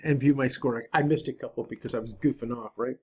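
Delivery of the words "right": ordinary delivery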